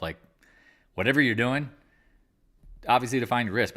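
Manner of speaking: normal talking voice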